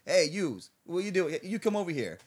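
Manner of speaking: put-on a light Italian mobster accent, calling him over; Italian mafia accent. Calling the line cutter over in a light Italian mafia accent. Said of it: "Italian accent".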